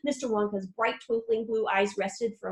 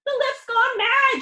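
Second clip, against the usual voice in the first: high, loud and slow; frightened shout; 13 semitones higher, 7 dB louder, slower than usual. A narrator's reading. Grandpa Joe shouting in fear at the runaway lift. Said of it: "shouted Grandpa Joe".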